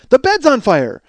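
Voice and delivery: high, loud warning; retold panicked shout; acted alarm. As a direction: mimic an urgent child's cry. Repeating alarm to mother.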